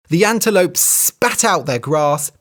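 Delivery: ordinary delivery, nothing marked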